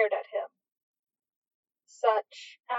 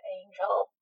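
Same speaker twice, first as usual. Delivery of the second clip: softer, lower exasperated groan; 3 semitones lower, 6 dB quieter than usual. A narrator's reading. Crowley groaning at his angel's word choice, exasperated. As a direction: sigh the name out in exasperation. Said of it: "Crowley groaned".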